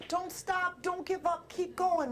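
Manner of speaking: encouraging mother. Acted. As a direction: urge them on like a mother. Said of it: "mothers encourage you".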